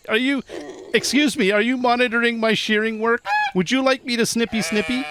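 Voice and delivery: high, huffy are you monitoring; mock indignation. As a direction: play offended at the sheep. Mock offence at a watching sheep.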